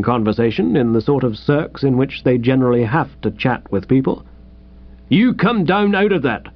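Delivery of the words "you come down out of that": policeman's order. Acted. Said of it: "he said".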